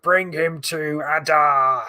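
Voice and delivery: slow, drawn-out orc command; mock orc growl. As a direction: imitate a menacing orc, stretching the final word. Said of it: "the orcs are like".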